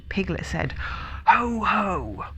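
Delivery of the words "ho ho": fierce. A narrator's reading. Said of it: "as fiercely as he could".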